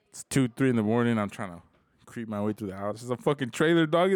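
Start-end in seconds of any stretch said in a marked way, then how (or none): none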